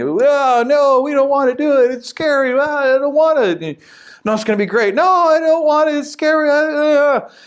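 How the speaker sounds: high, whimpering mock fear; scared whining spies. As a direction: whine like frightened children. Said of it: "most of the spies are saying".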